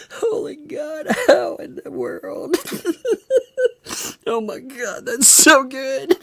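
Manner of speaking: high, breaking laughter; laughing. Real, not acted. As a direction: laugh through every word. Still laughing.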